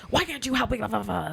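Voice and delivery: retold indignant demand; mock belligerent caller. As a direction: mimic an angry guest trailing into blah. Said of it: "belligerently angry".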